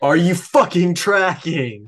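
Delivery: loud, high, slow mock demand; hammed-up angry interrogation; mock-aggressive. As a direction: bellow it slowly in pretend outrage. Jokingly repeats the aggressive question, amped up.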